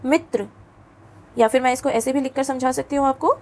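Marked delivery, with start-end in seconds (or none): 0.1-0.5 मित्र with a full, clear र; model pronunciation. Shown as a demonstration. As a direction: say मित्र giving र its full sound. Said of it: "र को पूरा उच्चारित करेंगे". (मित्र में र का पूरा उच्चारण)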